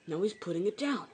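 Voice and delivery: ordinary delivery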